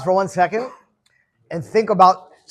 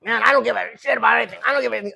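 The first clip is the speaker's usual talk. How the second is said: fast, pitched-up impression of a wild friend; imitating a brash friend, quick and high; wild guy; 3 semitones higher, faster than usual. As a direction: rattle it off like a reckless big dude. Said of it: "he's like".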